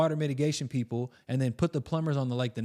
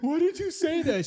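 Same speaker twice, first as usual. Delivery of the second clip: amused question. Retold or imitated. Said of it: "she goes".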